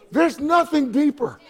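high, ringing preacher's proclamation; emphatic pulpit crescendo; impassioned preaching. Real, not acted. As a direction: build fervently and cry it out to the congregation. Preaches at full force.

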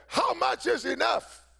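high, ringing preacher's shout that slows on the last line; old preacher shouting to the congregation; retold preacher's holler. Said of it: "He hollered out one day preaching".

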